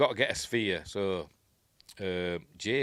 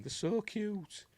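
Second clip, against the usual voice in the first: much higher, slow doting coo; cooing woman; 12 semitones higher, slower than usual. Retold or imitated. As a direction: coo over the little creatures. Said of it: "She's going".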